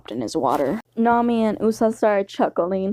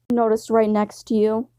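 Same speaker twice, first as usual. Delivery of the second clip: ordinary delivery, nothing marked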